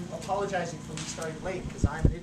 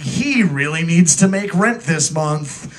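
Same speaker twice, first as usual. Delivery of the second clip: movie guy voice; 13 dB louder, much slower than usual. Acted. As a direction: boom it out like a movie trailer. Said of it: "I'm gonna do the movie guy voice".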